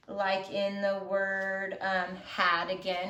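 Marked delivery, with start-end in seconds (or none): none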